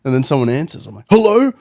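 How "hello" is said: high, loud, urgent hello; acted-out desperate phone greeting; anxious hello. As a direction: call out hopefully into the phone. Retold desperate caller when phone answered.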